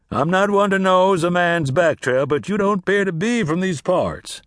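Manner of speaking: genial, showy travelling salesman; talkative drummer. Acted. Drummer making small talk with Cass about the heat. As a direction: chat like a friendly peddler.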